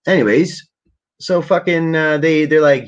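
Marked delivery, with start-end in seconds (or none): none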